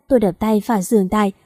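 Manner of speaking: usual speaking voice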